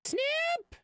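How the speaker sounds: high squeaky sing-song call; playful squeal. Pretend. Streamer playfully squeaks to chat about the snip tool, high and silly. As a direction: chirp it out high like a cartoon.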